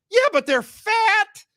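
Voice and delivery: hammed-up angry shout; mock furious rant. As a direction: yell it like an outraged passenger. Ranting punchline.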